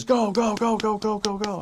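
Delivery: high-pitched rapid urging; urgent. Retold or imitated. Mission president urging the driver on, high urgent cries. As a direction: imitate a panicked command, repeated in a high voice. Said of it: "my mission president says".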